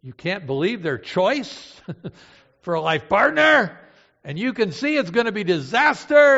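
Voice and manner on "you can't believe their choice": usual speaking voice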